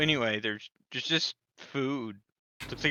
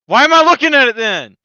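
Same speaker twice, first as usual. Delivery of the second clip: exasperated outburst; 9 semitones higher, 16 dB louder, faster than usual. Real, not acted. Player protests blank paper.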